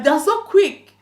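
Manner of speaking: high, loud, slow sneering; mock the quick debits; scornful jab; sarcastic. Sarcastic rant at scammer.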